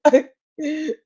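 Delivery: high, slow, tearful wail breaking into laughter; hammed-up sobbing, drawn out and laughing; mock crying wail. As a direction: imitate yourself sobbing down a voice message. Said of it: "audio message of me just going".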